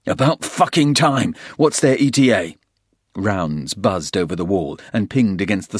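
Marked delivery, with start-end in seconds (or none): 0.1-2.6 loud, impatient yell; shouting. Acted. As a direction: bark the question over gunfire. Porter shouting in combat.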